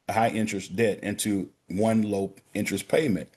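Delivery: ordinary talk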